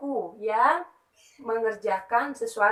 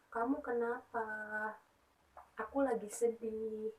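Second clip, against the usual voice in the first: sad example; 12 dB quieter than usual. Shown as a demonstration. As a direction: show the class how sadness sounds. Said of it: "kalau teman-teman lagi sedih".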